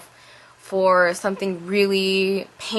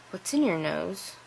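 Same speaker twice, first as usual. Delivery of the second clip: quiet; 8 dB quieter than usual. Retold or imitated. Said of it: "we talked like that to each other".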